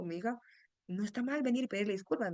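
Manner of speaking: usual speaking voice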